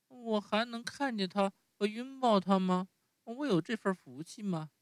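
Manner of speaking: 高出许多的稚嫩童声，带着依恋; 像小木偶一样怯生生地撒娇; 木偶孩子的声音. Acted. Narrator voices Pinocchio. (用高高的童声扮演匹诺乔)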